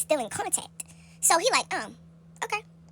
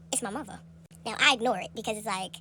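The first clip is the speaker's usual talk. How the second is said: ordinary delivery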